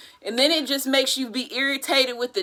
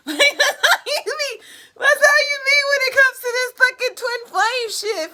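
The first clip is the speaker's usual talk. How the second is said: high-pitched, laughing exclamation; 12 semitones higher than usual. Real, not acted. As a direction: squeal it through laughter. Laughing about twin flame frustration.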